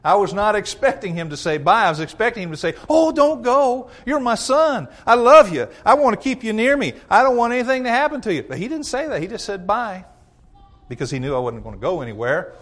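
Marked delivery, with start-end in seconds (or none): 2.9-8.4 much higher, mock-tender pleading; imagined father begging his son to stay; pleading dad. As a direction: beg the boy to stay, hammed-up.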